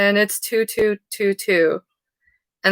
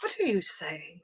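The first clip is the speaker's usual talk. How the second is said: hushed indignation; 15 dB quieter than usual. Retold or imitated. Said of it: "It's like".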